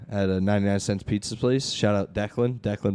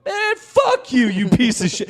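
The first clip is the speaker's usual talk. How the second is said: mock furious outburst; 11 semitones higher than usual. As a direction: explode like a refused beggar. Imitating refused beggar turning abusive.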